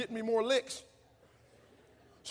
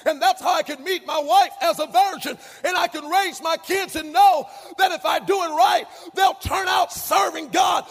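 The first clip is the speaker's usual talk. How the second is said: loud, higher preaching cadence; full-force preaching; 6 semitones higher, 9 dB louder than usual. Acted. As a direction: build the sermon to a roar.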